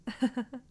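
a quick amused laugh; short laugh. Real, not acted. Laugh mid roleplay.

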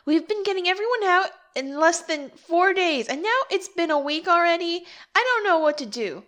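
high-pitched frustrated complaint; exasperated whine. Acted.

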